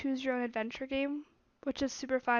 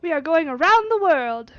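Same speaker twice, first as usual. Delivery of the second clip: loud, grand declaration; louder, proud proclamation; loud announcement; 4 semitones higher, 10 dB louder than usual. Acted. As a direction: announce it boldly to the valet. Fogg announces the journey.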